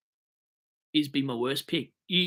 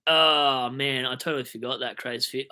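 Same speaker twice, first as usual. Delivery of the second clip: usual speaking voice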